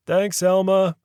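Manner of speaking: conductor thanking. A narrator's reading.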